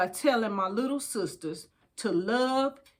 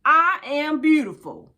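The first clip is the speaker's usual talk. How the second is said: louder, proud call to repeat; affirmation lead; 4 semitones higher, 5 dB louder than usual. Shown as a demonstration. Reading to her little sisters, a proud affirmation chant. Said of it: "Now repeat after me".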